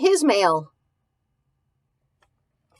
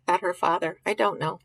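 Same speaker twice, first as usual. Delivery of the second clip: plain reading voice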